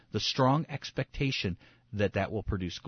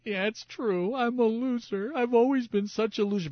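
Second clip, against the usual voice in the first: high, whiny mock self-pity; self-pitying sinful self; 12 semitones higher, 3 dB louder than usual. Sinful self agreeing it's a loser, mock pitiful. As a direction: wallow in it in a much higher put-on voice. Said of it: "sinful self, the war that happens inside of you goes".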